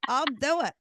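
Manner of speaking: squeaky, giddy, laughing yes to an easy offer; eager high-pitched mock delight. Agreeing to hypnotherapy, squealing with mock glee. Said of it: "And I was like".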